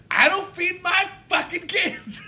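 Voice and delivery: high, slow, mock offended; offended drunk mom. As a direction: drag out the outrage way up high.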